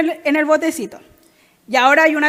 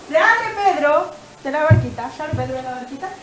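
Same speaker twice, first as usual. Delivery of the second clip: ordinary delivery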